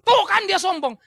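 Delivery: high, loud mock accusation; imitated loud finger-pointing; accusing opponent. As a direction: pounce on it like a triumphant critic. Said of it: "lawan Paulus langsung bilang".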